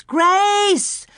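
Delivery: high, loud desperate scream; girl screaming for lost sister. A narrator's reading. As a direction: scream her name across the prairie. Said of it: "she screamed".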